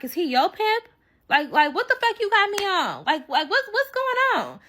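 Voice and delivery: very high, stammering disbelief; retold incredulous outrage. Asking friend about the pimp, incredulous outrage. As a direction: climb high as you demand answers.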